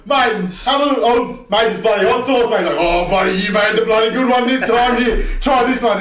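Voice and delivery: loud high-pitched Aussie bloke voice; gym old blokes. Retold or imitated. Mocking the old cobbers in the sauna, loud high bloke voice. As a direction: ham up a matey old cobber offering hot sauce. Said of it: "one of them goes".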